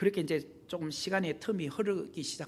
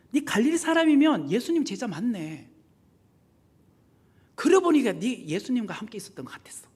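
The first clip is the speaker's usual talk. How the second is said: loud accusation, then dropping to a hush; accusing; 4 semitones higher, 6 dB louder than usual. Retold or imitated. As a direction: call him out, then hiss the suspicion. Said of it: "이렇게 말을 하기 시작한 거예요". (크게 따지다가 속삭이는 말투)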